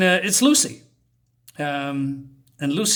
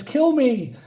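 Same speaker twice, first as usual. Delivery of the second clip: ghostly plea; 10 semitones higher, 3 dB louder than usual. Acted. Burned man's ghost in bed.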